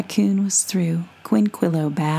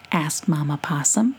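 ordinary delivery, nothing marked

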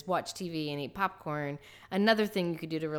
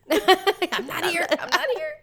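giggling high voice; laughing; 12 semitones higher, 7 dB louder, slower than usual. Pretend. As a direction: laugh while pretending to hide. Playful hiding role.

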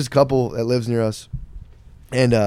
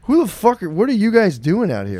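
mock indignant yell; 9 semitones higher than usual. Woman yelling at kids in the park, mock outraged shout. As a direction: play it as a neighbor yelling at teens in the park. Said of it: "She's like".

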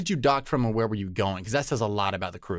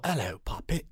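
put-on softer, higher drunk British lilt; Captain Sparrow; 4 semitones higher, 5 dB quieter than usual. Co-host recalling the actor's pitch to Disney, drunk British accent. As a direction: imitate a tipsy British pirate. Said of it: "he was like a drunk British".

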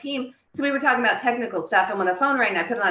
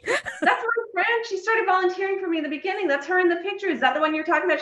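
much higher, excited; high pitched, thrilled realization; excited recognition; 12 semitones higher than usual. Retold or imitated. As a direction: squeal out the recognition.

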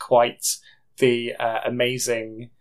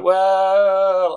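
drawn-out, higher, louder "well"; acted KGB recruiter; 6 semitones higher, 5 dB louder than usual. Mysterious KGB man answering her refusal, drawn-out acted reply. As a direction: stretch it out as a mock-reluctant threat. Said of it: "he's like".

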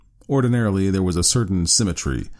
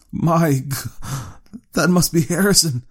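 higher, startled man's voice; George's alarm; 10 semitones higher than usual. A narrator's reading. George recognizing his son on TV, startled. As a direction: gasp it out in shock. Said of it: "said George".

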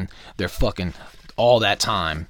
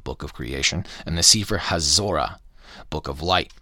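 measured, lower-pitched book narration; reading aloud; 4 semitones lower than usual. A narrator's reading. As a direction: read from the book with deliberate emphasis.

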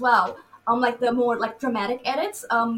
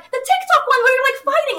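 eager explanation; 12 semitones higher, 7 dB louder, faster than usual. Retold or imitated. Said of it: "It's like".

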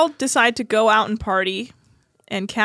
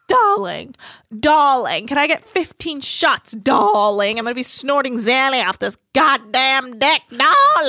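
louder, drawn-out posh darling voice; posh drunk accent; 3 semitones higher, 6 dB louder than usual. Retold or imitated. Mocking a drunk reality star's posh accent, louder. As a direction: ham up a tipsy socialite ordering. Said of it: "That was a correct accent".

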